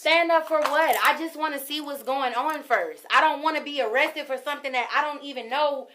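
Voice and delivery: loud and pleading, pitch up. Real, not acted. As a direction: protest loudly, on the edge. Resisting arrest, raised voice.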